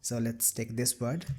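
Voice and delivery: ordinary delivery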